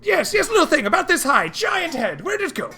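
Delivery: high, loud panicked sailor shouting; a crew member shouting to everyone, robbed and frantic; high shouting deckhand voice. Acted. Crew member answering, same voice.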